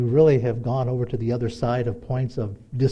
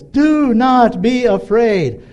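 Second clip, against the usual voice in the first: raised, slow, loud proclamation; proclaimed scripture reading; 5 semitones higher, 5 dB louder, slower than usual. Acted. Preacher reading God's promise to congregation, loud slow proclamation. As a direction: declaim God's words slowly and high.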